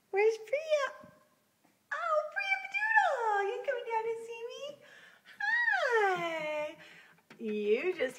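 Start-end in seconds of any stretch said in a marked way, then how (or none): 0.1-0.9 very high sing-song call. Real, not acted. Pet sitter calling the cat in high baby talk. 1.9-6.8 very high, slow cooing, then a quick squeaky question. Real, not acted. Pet sitter cooing at the cat over video, very high baby talk.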